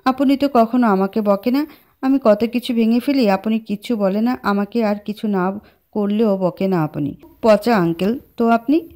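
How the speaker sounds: plain reading voice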